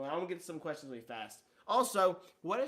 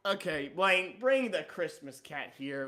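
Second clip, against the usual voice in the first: ordinary talk, nothing marked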